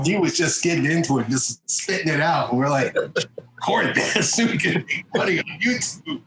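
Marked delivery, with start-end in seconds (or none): 2.9-6.2 laughing. Retold or imitated. Laughing while quoting himself.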